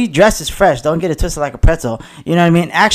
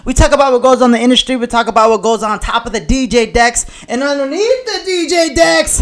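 hype man; 7 semitones higher than usual. Acted. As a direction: pump up the party crowd. Podcast hype intro.

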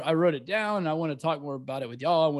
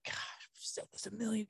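frustrated sigh; 7 semitones higher, 13 dB quieter than usual. Retold or imitated. Imitating own frustrated remark.

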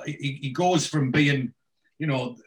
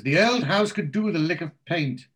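posh lady visitor; 6 semitones higher than usual. Retold or imitated. She says.

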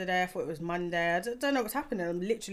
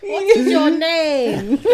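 slow, loud, higher mock interviewer; 8 semitones higher, 15 dB louder, much slower than usual. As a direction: ham up the host question. Host mock-interviews Kai.

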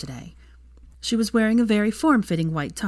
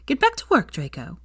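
stern order. A narrator's reading. Scolding Draco.

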